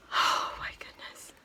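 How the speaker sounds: breathless hush of wonder; awed whisper. Real, not acted. Looking at the gift, whispering in awe.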